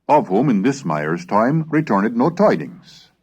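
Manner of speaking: put-on measured reading of an old chronicle; steady period narration. A narrator's reading. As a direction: read the archaic text like a storyteller. Reads old chronicle aloud.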